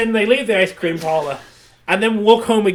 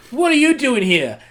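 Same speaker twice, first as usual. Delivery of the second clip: startled; 7 semitones higher, 6 dB louder than usual. Retold or imitated. Boyfriend startled in bed, retold.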